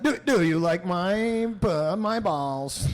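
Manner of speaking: slow dragging glitchy robot; slow down like a dying machine; tape stop. Pretend. Robot slowing down.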